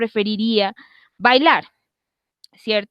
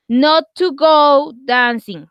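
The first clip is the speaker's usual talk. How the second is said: slow, louder, careful English; slow model phrase; 2 semitones higher, 5 dB louder, much slower than usual. Shown as a demonstration. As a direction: stretch it out for learners. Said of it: "teacher models negation slowly".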